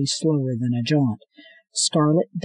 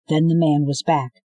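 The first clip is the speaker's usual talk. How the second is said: plain reading voice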